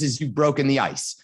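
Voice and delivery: usual speaking voice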